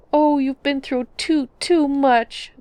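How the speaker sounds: high, loud mock bawling; a hammed-up wail, words drawn out slow. A narrator's reading. Nord woman bawling over the strangers' tale, high and loud. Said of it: "the Nord woman bawled".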